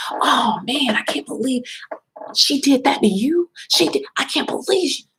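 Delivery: high, exaggerated pretend shock; host faking outrage about the attacker, mocked high; mock fake outrage. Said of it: "all this fake outrage".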